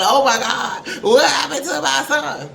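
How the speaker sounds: a hint of a hammed-up grieving parent; mock crying mother. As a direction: parody the mother's wailing. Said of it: "And then you crying".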